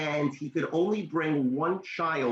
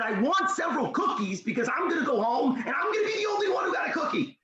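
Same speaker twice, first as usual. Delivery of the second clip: put-on high, excited child's voice, pleading; a small boy who badly wants this; eager little boy; 11 semitones higher, faster than usual.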